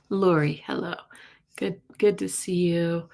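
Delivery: usual speaking voice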